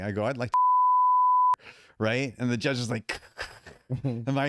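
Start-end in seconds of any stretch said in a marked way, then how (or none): 0.2-1.6 high, drawn-out strangled squeal; strangled cry. Retold or imitated. On the stand, a loud high strangled cry. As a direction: squeal instead of finishing the threat.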